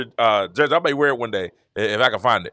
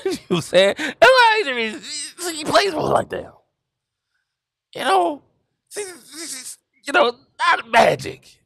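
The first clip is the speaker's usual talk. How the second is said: high, laugh-shaken words; 11 semitones higher, slower than usual. Real, not acted. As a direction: talk through the giggles. Laughing at his own comparison.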